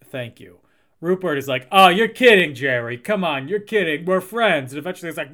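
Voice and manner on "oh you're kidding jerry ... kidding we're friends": loud, insistent chummy protest; disbelieving protest. Acted. Rupert protesting to Jerry that they're friends, loudly insistent. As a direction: brush it off as a joke, loudly. Rupert refuses to take the hint.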